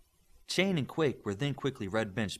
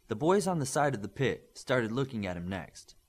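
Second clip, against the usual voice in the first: plain reading voice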